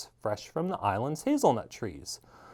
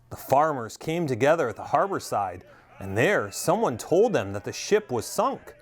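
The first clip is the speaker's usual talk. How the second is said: usual speaking voice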